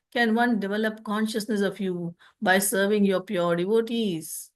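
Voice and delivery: usual speaking voice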